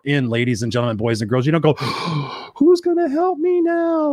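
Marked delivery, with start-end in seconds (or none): 1.8-2.5 mock gasp. Mock panic before plea. 2.6-4.1 high, slow, mock-helpless whimper; whiny helpless plea. Mocking a helpless person.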